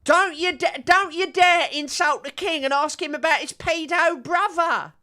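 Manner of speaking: very high-pitched caricature of an outraged idiot; mock-indignant royalist. Streamer mocking royalists defending the king, high indignant idiot voice. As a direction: scold in a silly, squeaky loyalist voice. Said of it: "all of these idiots who are like".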